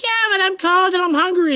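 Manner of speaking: put-on high, whining child, complaining; whiny kid voice. Kid complains to parents from the back seat, whining. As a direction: sound like a bored kid in the car. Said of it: "And the kid's like".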